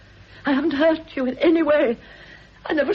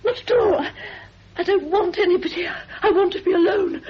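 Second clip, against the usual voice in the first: tearful pleading; 4 semitones higher than usual. Acted. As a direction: beg her to stop through tears. Frightened woman, still crying.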